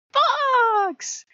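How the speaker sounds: very high, loud show-off fox voice; obnoxious fox. Retold or imitated. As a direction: yap it out like a pushy cartoon fox hogging the spotlight. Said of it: "are obnoxious".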